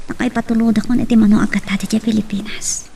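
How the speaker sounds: unvoiced secret whisper. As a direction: whisper it so no one hears. Secret whispered aside after call.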